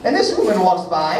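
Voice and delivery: ordinary talk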